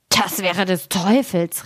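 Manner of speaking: slow, drawn-out astonished cry; miller's exclamation. A narrator's reading. Miller astonished at the raven's prophecy, exclaiming slowly. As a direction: exclaim it slowly, without raising the volume. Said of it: "rief der Müller".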